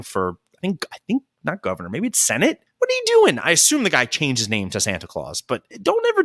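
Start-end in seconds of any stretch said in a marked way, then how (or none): 2.8-3.4 exasperated. Real, not acted. Exasperated outburst at name change.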